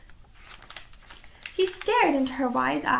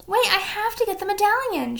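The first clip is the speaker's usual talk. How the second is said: put-on high boyish shout; 7 semitones higher than usual. A narrator's reading. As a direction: call it out urgently. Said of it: "shouted Jack".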